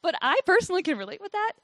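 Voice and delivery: ordinary delivery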